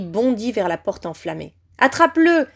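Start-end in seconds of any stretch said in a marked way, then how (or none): 1.8-2.5 loud, high-pitched order; shouted command. A narrator's reading. Villain orders servant to seize the boy, shouting. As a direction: cry out an urgent order. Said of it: "cria alors Voldemort".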